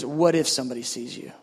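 low, measured question back; calm voice of God. Retold or imitated. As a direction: turn the worry back calmly and low. The Lord answers him back.

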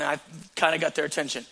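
ordinary delivery

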